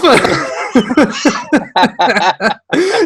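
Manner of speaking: shrieking burst of laughter; high-pitched laugh. Real, not acted. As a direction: howl with laughter. Cracking up at own story.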